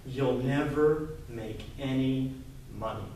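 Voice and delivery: slow, low, heavy doom; slow low mock-ominous verdict; dismissive warning. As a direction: drag it out like a scared grown-up's warning. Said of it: "the same thing that most every person ... who was scared".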